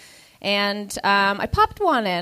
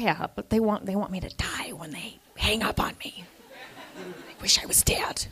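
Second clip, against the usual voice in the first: soft deadpan whisper; despairing whisper; 2 semitones lower, 8 dB quieter than usual. Retold or imitated. Employee to manager about callers, hopeless whisper. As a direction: whisper it like you have given up. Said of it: "I was like".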